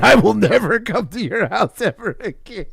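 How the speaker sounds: high, loud, wobbly mock vow; hammed-up quavering quote; mocking imitation. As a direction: mimic him in a wildly exaggerated high voice. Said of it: "He said".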